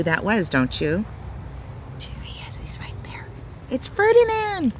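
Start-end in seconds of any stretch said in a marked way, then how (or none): 2.0-3.3 hushed secret whisper. Real, not acted. Reader whispering to listeners before the big reveal. 3.7-4.7 high, delighted announcement; excited reveal. Real, not acted. Reader revealing Ferdinand to listeners, excited. Big reveal after whisper.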